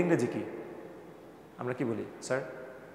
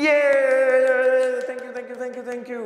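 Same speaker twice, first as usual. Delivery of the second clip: excited cheer; 10 semitones higher, 6 dB louder, much slower than usual. Real, not acted. Cheering a right answer.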